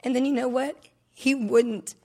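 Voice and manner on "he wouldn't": crying through the words. Real, not acted. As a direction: voice breaking into real tears. Confessing painful marriage past.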